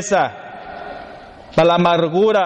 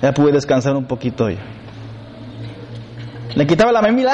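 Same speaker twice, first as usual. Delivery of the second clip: normal talking voice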